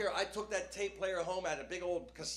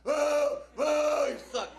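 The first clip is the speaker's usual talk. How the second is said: higher, louder jeering yell; crowd jeers; 10 semitones higher, 10 dB louder, much slower than usual. Retold or imitated. Comic re-enacting punks booing him, higher louder yell.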